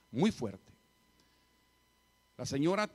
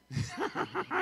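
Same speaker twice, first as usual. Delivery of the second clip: high-pitched burst of laughter; real amused laugh after a joke. Real, not acted. Joking that he is not serious. (carcajada aguda)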